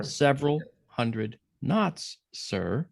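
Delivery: slowly, word by word; slow emphatic repeat. Real, not acted. Storyteller repeating the speed for listener, slow and deliberate. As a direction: spell out "several hundred knots" with weighty pauses.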